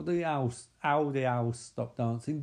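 ordinary talk, nothing marked

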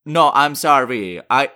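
robotic synthesized speech, evenly paced; computer voice. Acted. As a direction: speak like a talking computer.

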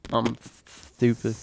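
imitating a lisp on stupid; mock lisp. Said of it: "With your S's, you kinda just like".

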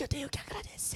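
playful hush fading to pure whisper; airy, very soft ASMR parody; mock ASMR whisper. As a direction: whisper back as if on an ASMR podcast. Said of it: "fazer um podcast A S M R".

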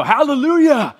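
loud, high burst of praise; exuberant hallelujah. Shown as a demonstration. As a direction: fire it back at the mockers with joy. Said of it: "and you go".

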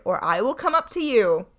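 loud threatening call; angry shout. A narrator's reading. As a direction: threaten her up the stairs. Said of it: "shouted Bluebeard".

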